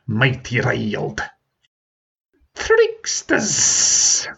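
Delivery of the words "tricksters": much higher, spat accusation; accusing dragon. Acted. Riled dragon accusing the humans, much higher. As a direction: dragon riled, calling them tricksters. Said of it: "he accused".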